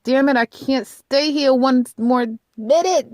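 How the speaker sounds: high-pitched, acted frustration; exasperated outburst. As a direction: burst out as if fed up and trapped. Voicing someone desperate to get out.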